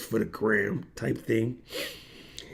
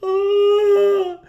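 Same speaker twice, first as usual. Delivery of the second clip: awed gasp; 6 dB louder than usual. Retold or imitated. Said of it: "It was just like".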